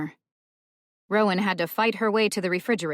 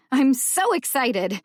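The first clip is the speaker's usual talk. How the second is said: excited; 7 semitones higher, 5 dB louder than usual. A narrator's reading. Gleeful schemer.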